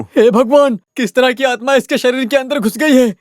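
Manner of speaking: high-pitched, horrified cry to God; aghast exclamation. Acted. Aghast at supposed possession. (घबराकर ऊँची आवाज़ में दुहाई)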